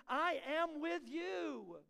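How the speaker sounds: slow tender promise. Acted. God's words quoted, slowly.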